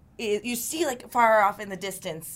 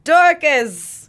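calling out; 6 semitones higher, 9 dB louder than usual. Acted. Calling out in the forest.

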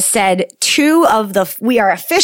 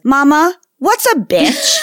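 put-on high, slow little-girl question; toddler voice; 7 semitones higher, slower than usual. Mom retells to cohost, voicing her toddler innocently. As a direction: ask it like a curious toddler. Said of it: "she goes".

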